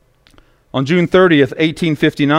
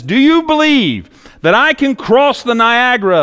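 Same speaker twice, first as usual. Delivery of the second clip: higher, lightly voiced showman call; Blondin's big challenge; 7 semitones higher than usual. Blondin calling out to the crowd over Niagara, higher. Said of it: "he turns to the crowd and he says".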